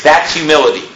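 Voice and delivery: ordinary talk, nothing marked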